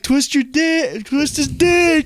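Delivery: high, drawn-out mock yell; retold heckling scream. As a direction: imitate chanting at the stage, stretching the words. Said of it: "we kept screaming".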